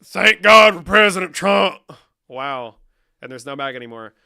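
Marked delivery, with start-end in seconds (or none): 0.0-1.8 slow, high, booming cheer; drawn-out full-volume proclamation; mock-fervent shout. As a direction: holler it out slowly like a rally chant. Streamer bellows a mock cheer.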